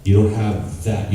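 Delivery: ordinary talk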